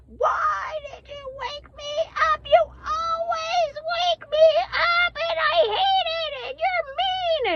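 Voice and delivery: high, drawn-out wailing imitation of a child; child's angry meltdown. Mother imitates her woken child, wailing meltdown. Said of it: "she's just melting down".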